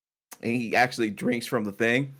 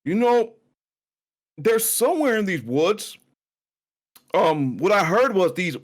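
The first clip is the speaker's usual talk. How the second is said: higher NPC voice; 8 semitones higher than usual. Acted. GM voicing Corwin, higher.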